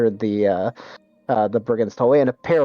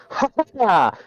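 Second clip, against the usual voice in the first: high hammed-up villain laugh; theatrical laugh; 10 semitones higher than usual. Hidden brigand reveals himself with high theatrical laugh. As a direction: announce yourself with a grand mocking laugh. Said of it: "you hear a voice go".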